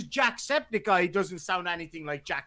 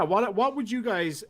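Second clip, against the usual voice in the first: ordinary delivery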